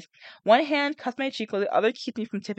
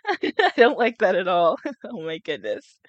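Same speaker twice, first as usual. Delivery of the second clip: laughing disgust; 4 dB louder, slower than usual. Real, not acted. Reader giggling in disgust at a gory passage. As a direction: giggle in disgust, then trail off.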